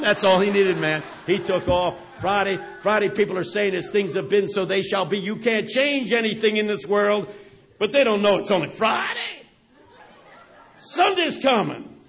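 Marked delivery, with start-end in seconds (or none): 2.2-11.9 louder and higher, rising preacher's cadence; preaching. Acted. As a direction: preach it like the old pastor taking off. Preacher's sermon acted out, building.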